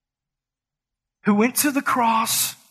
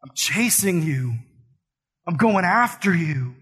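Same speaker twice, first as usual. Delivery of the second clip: tender; 4 semitones lower than usual. Retold or imitated. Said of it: "Jesus is like".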